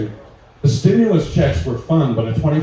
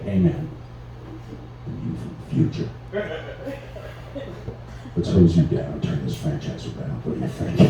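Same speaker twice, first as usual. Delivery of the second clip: much lower, hushed put-on voice; homeless dude pitch; 11 semitones lower, 8 dB quieter than usual. Walking up to a homeless double with a toonie, low hushed voice. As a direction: drop way down and half-whisper the offer. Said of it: "just walked up to him with a toonie and be like".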